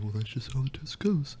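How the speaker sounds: calm teacher. Retold or imitated. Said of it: "he was like".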